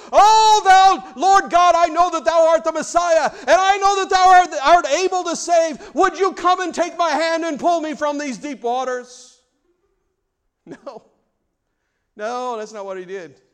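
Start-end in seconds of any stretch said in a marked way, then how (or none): 0.1-9.4 very loud, high, mock-grand King James prayer; fancy prayer. Preacher mocking what Peter didn't pray, booming and lofty. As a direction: belt out a pompous olde-English prayer. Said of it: "He didn't say".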